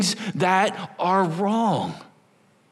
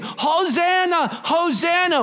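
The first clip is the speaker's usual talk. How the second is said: high, slow drawn-out hosanna; joyful festival call; crowd cheering; 11 semitones higher, slower than usual. Retold or imitated. Crowd cheering Jesus on Palm Sunday.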